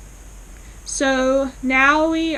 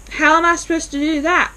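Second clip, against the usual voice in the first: exasperated; 6 semitones higher, 5 dB louder, faster than usual. Retold or imitated. Aunt Sarah objecting to the house sale.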